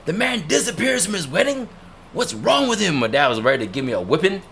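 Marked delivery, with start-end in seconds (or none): 0.0-2.9 much higher, exasperated parent voice; imitating scandalized parents, pitched way up; mock outraged parents. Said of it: "They're like".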